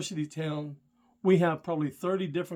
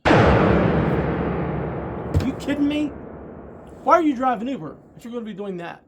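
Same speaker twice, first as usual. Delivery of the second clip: real outrage; 7 semitones higher, 8 dB louder than usual. Real, not acted. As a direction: let the anger burst out, real and raw. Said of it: "I'm sorry I get worked up about this".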